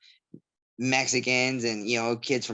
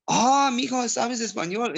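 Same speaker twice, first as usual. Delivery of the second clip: mock delighted surprise; 10 semitones higher, slower than usual. Spanish speaker discovering he speaks Spanish, imitated in high surprise. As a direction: exclaim like a thrilled Spanish-speaking elder.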